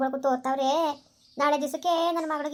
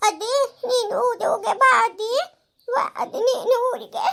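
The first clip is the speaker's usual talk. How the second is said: put-on high, louder child voice half crying, half laughing; a small girl torn up at parting; tearful little girl; 9 semitones higher, 4 dB louder than usual. Daughter says goodbye to grandma, high tearful child voice.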